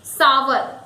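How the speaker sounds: "sour" alone as SAA-wer; two syllables in "sour", SAA-wer; correct sour. Shown as a demonstration. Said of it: "it is sour".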